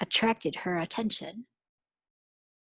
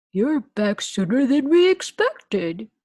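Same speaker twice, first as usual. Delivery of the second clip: lightly voiced surprised principal; a principal mildly taken aback; the principal welcoming the teacher back; 5 semitones higher, 8 dB louder than usual. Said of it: "he said".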